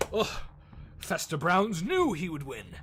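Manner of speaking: boastful showman Fester. Acted. Innkeeper host offering the elf a bet, pompously. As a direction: sell the bet like a grand carnival host.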